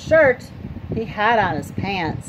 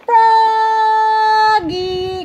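long, high, loud sung call; 12 semitones higher, 7 dB louder than usual. Acted. Mother calls Froggy from inside, long sung call. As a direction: call him from far off, singing his name. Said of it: "called his mother".

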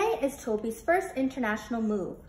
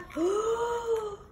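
big gasp; 11 semitones higher than usual. Shown as a demonstration. Reader showing young listeners another gasping breath. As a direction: gasp for air after the rush. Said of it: "Take another deep breath".

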